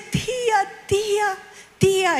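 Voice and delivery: put-on high child's cry, fading on the second. Niece wakes at night calling her aunt, high crying call. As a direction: call out like a scared little girl. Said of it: "Decía".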